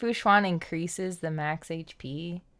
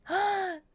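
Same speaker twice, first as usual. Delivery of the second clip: sharp high gasp of delight; 13 semitones higher than usual. Real, not acted. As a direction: gasp as if seeing something amazing. Sees the new character sprite.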